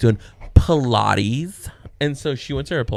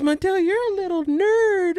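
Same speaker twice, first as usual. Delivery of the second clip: put-on high, teasing child voice; imitated little daughter; 4 dB louder than usual. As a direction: mimic a girl teasing her baby brother. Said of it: "And she goes".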